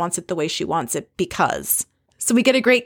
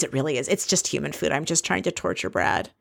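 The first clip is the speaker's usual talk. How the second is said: mock deadpan [name]; 4 semitones lower, 6 dB quieter than usual. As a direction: lower and softer. [name]'s deadpan admission.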